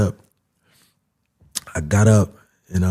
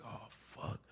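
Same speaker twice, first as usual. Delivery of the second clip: hushed, breathless oh fuck; whispered realization; dismayed whisper; 30 dB quieter than usual. Retold or imitated. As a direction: let the dread escape under breath. Realizing he was shot.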